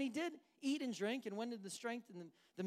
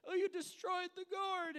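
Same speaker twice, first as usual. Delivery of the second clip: high, loud mock outrage; mad at God; 11 semitones higher, 5 dB louder than usual. Preacher acting Jonah complaining to God, mock mad. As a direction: wail a complaint like a sulking prophet. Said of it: "and he's mad at God".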